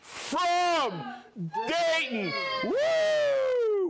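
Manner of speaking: high, loud cheer ending in a whoop; excited whoop. Real, not acted. Host cheering Dirk's arrival for viewers, loud excited whoop. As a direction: let it burst out into a cheer. Excited reveal of a special guest.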